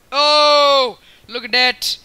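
loud, high surprised cry; amazed shout. Real, not acted. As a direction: gasp out loud at the shot. Seeing a trick shot.

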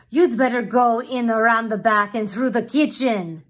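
loud, dismissive palace guard; mocking guard. Guard laughing off the rooster, loud and dismissive. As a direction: wave the rooster off round the back. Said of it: "The other one said".

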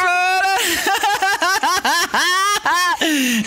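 long, high laughing fit; hysterical laugh. Real, not acted. Player laughs as coach shouts instructions, hysterical laugh. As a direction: laugh helplessly, pitched high. Laughing amid frantic play.

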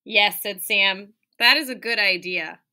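lively, expressive read-aloud; animated picture-book reading; reading to children. Acted. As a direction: read it like storytime for little kids. Teacher reads picture book aloud.

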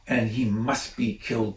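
ordinary delivery, nothing marked

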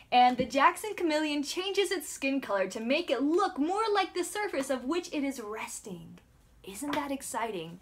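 ordinary delivery

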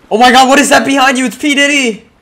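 high, fast fake panic shout; mock alarm. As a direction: shriek a fake warning.

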